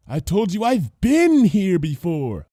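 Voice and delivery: patient correction; lightly voiced Lyra. A narrator's reading. Lyra correcting Bon Bon, patient.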